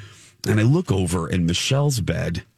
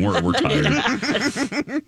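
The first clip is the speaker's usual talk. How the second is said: laughing complaint; 11 semitones higher, much slower than usual. Retold or imitated. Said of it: "Oh my God, please".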